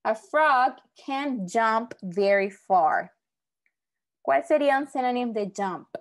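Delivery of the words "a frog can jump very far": slow, louder, word-by-word read; slow example reading. Shown as a demonstration. Teacher reading an example sentence to the class, slow and clear. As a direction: read it slowly and project to the class. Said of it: "¿verdad?".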